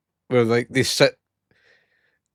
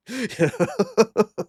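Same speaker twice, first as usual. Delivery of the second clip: laugh; 9 semitones higher than usual. Real, not acted. Laughing at his own story.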